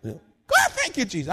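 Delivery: put-on hollering praise like an old-time shouter; church shout. Shouting John at church, hollering high with joy. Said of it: "he just shout".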